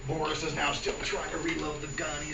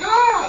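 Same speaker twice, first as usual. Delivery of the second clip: high, loud panicky shriek; mock servants' scream; 11 dB louder than usual. Servants freaking out at the noise, mock shriek. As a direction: scream in hammed-up fright.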